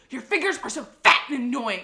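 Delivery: loud, snippy complaint; lightly voiced rude clerk; mock clerk scolding. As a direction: scold like an irritated official. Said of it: "And she's like".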